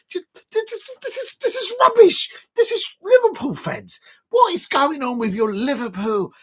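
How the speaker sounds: high, loud exasperated cry; exasperated rant. Real, not acted. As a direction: blast it out in disbelief. Ranting at rivals' defending.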